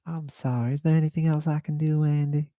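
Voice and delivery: high gentle flamboyant Southern offer of help; Keith's flamboyant Southern voice. Retold or imitated. Keith asking Andy if he can help, high camp Southern voice. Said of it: "this is how he talked".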